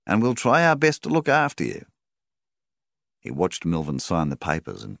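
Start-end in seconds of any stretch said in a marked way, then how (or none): 0.1-1.9 raised, loud banker's voice; banker Rawlings. A narrator's reading. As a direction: address a customer as the banker, pitched well up. Banker Rawlings talking to his customer.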